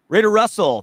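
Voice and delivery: loud, high call of the name; reading the super chat, a loud happy shout-out; excited shout-out. Real, not acted. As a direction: call out the fan's name with a burst.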